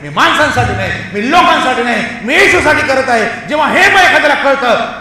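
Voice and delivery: loud, insistent declaration climbing in pitch; forceful preaching. Real, not acted. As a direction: declare it emphatically, point by point.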